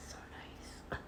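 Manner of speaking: soft breathy whisper close to the mic. Real, not acted. Alone, smelling the watermelon product, a soft whisper. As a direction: whisper it like a secret.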